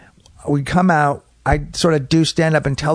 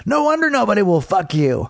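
higher-pitched heckle from the back; retold jeer in a raised voice; heckler calling out; 7 semitones higher than usual. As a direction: imitate a man calling across a room. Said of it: "a guy stands up in the back of the room and goes".

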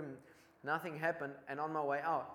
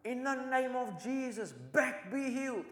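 retold shout; 9 semitones higher, slower than usual. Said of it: "and I shout".

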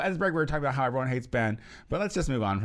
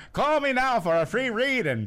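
laughing, raised mock Jamaican pitch; half-laughing bad Jamaican accent; hammed-up psychic ad voice; 9 semitones higher, 4 dB louder than usual. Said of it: "do a Jamaican accent".

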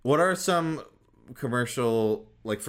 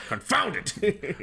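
put-on slow, high pompous old colonel; pompous sputtering colonel; 8 semitones higher, slower than usual. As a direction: sputter with blustering outrage. Sputtering mock outrage.

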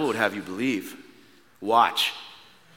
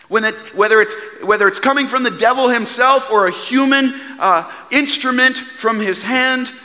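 loud, raised, shouting sermon voice; full-force preaching; 9 semitones higher, 6 dB louder than usual. Acted. Preacher urging the congregation to bold faith, shouting. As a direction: drive it home to the congregation.

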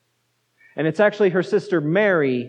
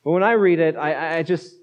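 usual speaking voice

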